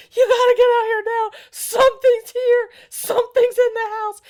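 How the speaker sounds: high, loud, urgent panic on the phone; panicked. Retold or imitated. Husband on the phone begging the exterminator, panicked. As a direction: shout it like something's in the house. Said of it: "He's on the phone with the exterminator".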